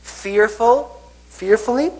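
fearfully slow then jumping high; playful scared fearfully; mock fearful. Shown as a demonstration. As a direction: act out being scared on fearfully. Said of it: "And then".

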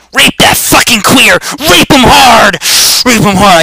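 loud, high battle yell; aggressive yelling. Pretend. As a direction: yell it high and hard at an opponent. Yelling during in-game attack.

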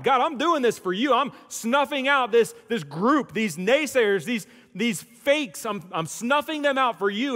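put-on loud, high, fervent voice of the young Paul; zealous persecutor. Paul vowing to God to crush the Christians, loud fervent zeal. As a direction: proclaim it like a fanatic sure of God's favour. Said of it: "he thought he was doing it on behalf of God".

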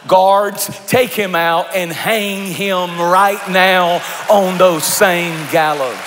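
furious king calling for the gallows; enraged king. Acted. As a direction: order the guards in fury. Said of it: "And he says".

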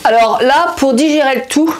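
talking with food in mouth; chewing while talking. Real, not acted. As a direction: mouth partly full of snack. Seen 0: Bites bar at -3; mouth alternately closed while reading, holding bar.

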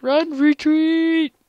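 high, slow battle cry; shouting retreat. Pretend. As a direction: call the troops back. Calling out retreat.

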